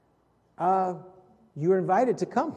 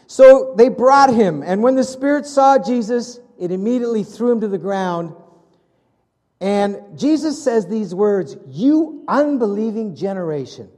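forceful preaching; 5 semitones higher, 10 dB louder than usual. Acted. Preacher retelling the Gospel to his church, forceful preaching. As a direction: proclaim it to the whole congregation.

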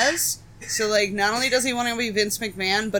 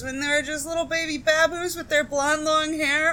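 fond cooing; 6 semitones higher than usual. Real, not acted. Cooing over young wrestlers.

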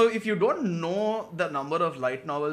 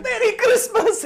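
very high, laughing mock-cheer; hammed-up [name], very high and laughing; laughing squeal; 8 dB louder, slower than usual. [name] repeats with the cash.

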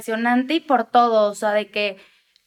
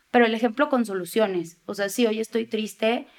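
usual speaking voice

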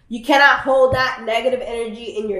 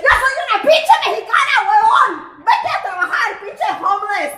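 angry shouting; 8 semitones higher than usual. Retold or imitated. Woman retelling how she cursed out a homeless man, angry shouting. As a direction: yell it high as a furious comeback.

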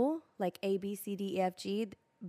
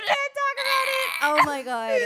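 laughing; 13 dB louder than usual. Real, not acted. Embarrassed laughing outburst.

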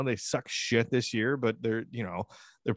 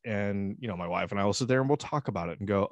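ordinary delivery

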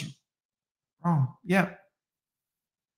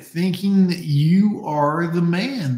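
ordinary talk

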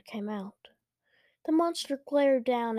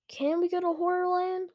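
high-pitched, eager question; eager asking; 7 semitones higher, faster than usual. A narrator's reading. Luke asking parents for Horrorland, eager. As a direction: ask keenly, pitched up.

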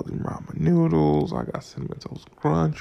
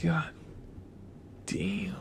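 hushed amazement; 3 semitones higher, 8 dB quieter than usual. Retold or imitated. Awed reaction in warehouse.

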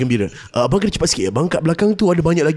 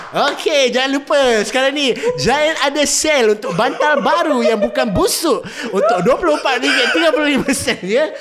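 mall MC voice; 10 semitones higher than usual. Retold or imitated. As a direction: grill the kid like a cheesy mall MC. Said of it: "the M Cs at the Giant".

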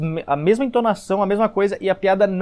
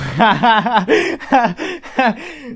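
loud burst of laughter; 5 semitones higher, 5 dB louder than usual. Acted. Mock reply to old classmate.

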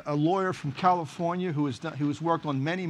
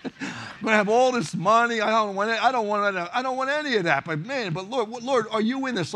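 excited; 3 semitones higher, 3 dB louder than usual. Retold or imitated. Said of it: "all of a sudden I'm thinking".

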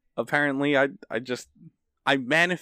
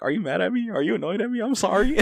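higher, rising worried pleading; anxious girlfriend; 8 semitones higher than usual. Retold or imitated. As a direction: imitate her nervous apology, climbing up. Girlfriend voiced, anxious apology.

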